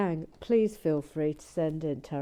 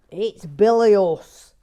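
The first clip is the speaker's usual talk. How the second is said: much louder, slow booming bilious; imitating Gary Blair; 3 semitones higher, 16 dB louder, much slower than usual. Mocking Gary Blair at full volume. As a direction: boom it like him. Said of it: "That's how he talks".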